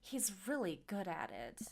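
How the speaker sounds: half-whispered secret, still voiced; mild stage whisper. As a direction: lean in and drop the volume. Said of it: "Mary leans in and whispers to me".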